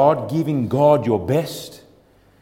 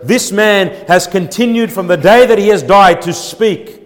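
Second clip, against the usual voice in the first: raised, higher preacher's delivery; forceful preaching; 5 semitones higher, 7 dB louder than usual. Acted.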